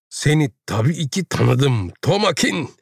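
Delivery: high, drawn-out recognition; emotional reunion. A narrator's reading. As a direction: lift the name high, greeting a lost lover. Linda greets her long-lost lover. (heyecanlı, tiz bir sesle)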